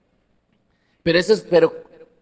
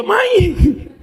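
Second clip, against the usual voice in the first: much higher, laughing cry; laughing protest; 10 semitones higher than usual. Retold or imitated. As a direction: laugh off the request. (protesta entre risas)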